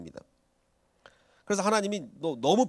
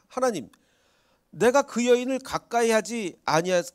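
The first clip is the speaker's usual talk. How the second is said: Abimelech pleading; 8 semitones higher, 5 dB louder than usual. Retold or imitated. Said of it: "아비멜렉이 이렇게 얘기합니다".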